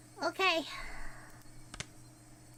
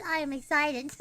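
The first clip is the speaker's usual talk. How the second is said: low-pitched excitement; 6 semitones lower than usual. Real, not acted. First take, low before laughing.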